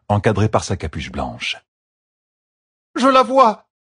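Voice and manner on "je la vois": much higher and louder joyful cry; cried out. A narrator's reading. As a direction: shout the discovery. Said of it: "cria la femme". (cri joyeux, bien plus haut et plus fort)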